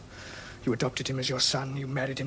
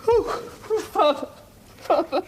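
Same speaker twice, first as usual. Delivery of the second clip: sobbing; 13 semitones higher, slower than usual. Acted. Son breaks down sobbing.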